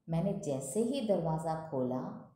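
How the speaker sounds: ordinary talk, nothing marked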